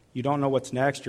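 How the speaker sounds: ordinary talk, nothing marked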